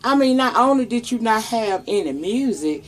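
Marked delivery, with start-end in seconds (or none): 0.0-1.8 high, incredulous squawk; outraged disbelief. Retold or imitated. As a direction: scoff at the screen in disbelief. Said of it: "I was like".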